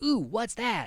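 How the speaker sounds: high, excited mock interjection; Sammy. Podcaster imitating a nosy lab visitor, high and curious. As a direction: pitch up on a curious ooh. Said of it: "Sammy's like".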